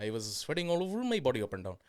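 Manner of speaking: quick put-on foreign accent; fast imitation of foreign-accented English. Shown as a demonstration. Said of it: "the stereotype to the accent".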